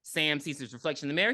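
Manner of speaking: ordinary talk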